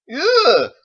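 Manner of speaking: loud, high yelp of fright; startled cry. Acted. Cowboy startled at the door, loud yelp. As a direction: jump back with a yelp. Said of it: "when he called out".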